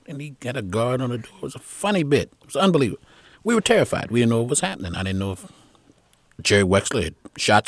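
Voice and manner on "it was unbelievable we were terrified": ordinary talk, nothing marked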